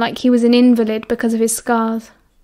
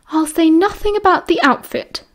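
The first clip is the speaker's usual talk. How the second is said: higher, prim and disapproving nurse voice; prim matron; 6 semitones higher than usual. A narrator's reading. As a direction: purse the lips and speak primly, a touch higher. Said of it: "she said primly".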